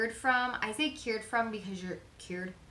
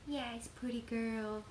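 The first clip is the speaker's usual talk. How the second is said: slow, softer cooing praise; soft pet talk; 3 semitones higher, 4 dB quieter, slower than usual. Real, not acted. Owner praising her pet in slow, cooing pet talk. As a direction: murmur fondly to the pet.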